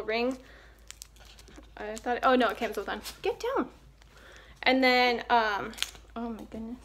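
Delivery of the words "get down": sharp command to dog. Real, not acted. Telling the dog off.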